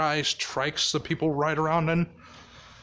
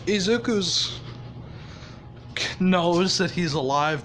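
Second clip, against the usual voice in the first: normal talking voice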